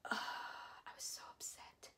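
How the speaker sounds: barely voiced, deflated whisper; upset whisper. Real, not acted. Fan after the set was shut down, crushed whisper. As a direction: let the words drop away into breath.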